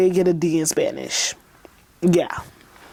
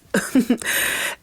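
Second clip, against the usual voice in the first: breathy laugh; 5 semitones higher than usual. Real, not acted. Laughs at her own line.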